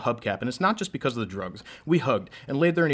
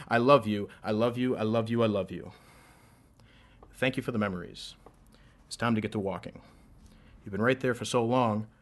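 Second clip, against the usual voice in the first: rhythmic, building slam delivery; spoken word poem; 5 dB louder than usual. Acted. Poet reciting a love poem to a crowd, spoken word. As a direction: perform a love poem on stage.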